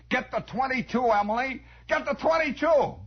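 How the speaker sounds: high, pressing command, rising on the repeat; acted fisherman's urgent order; urgent calling out. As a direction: call up to Emily for the gun.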